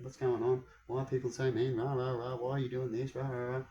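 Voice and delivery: quiet, gentle asking tone; soft nice approach. Retold or imitated. Man to online bullies, softly and nicely pleading. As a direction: sweetly ask why people are so mean. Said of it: "that's how I approached".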